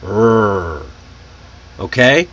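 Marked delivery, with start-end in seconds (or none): 0.0-0.8 light "rrr" growl from behind the teeth; sustained American R sound, tongue curled back. Shown as a demonstration. Teacher demonstrating the tongue position, lightly growled "rrr". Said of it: "coming from just behind your teeth".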